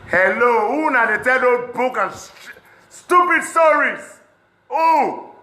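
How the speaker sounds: very high, loud, stretched cry of disbelief; outraged shout. Real, not acted. Demanding who spreads stupid stories, furious shout. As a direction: blast the question at whoever is lying.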